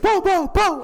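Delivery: high, loud shouted gun noises; imitated gunshots. Gunshots heard while the song plays, imitated in shouts. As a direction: shout rapid-fire shots with your voice.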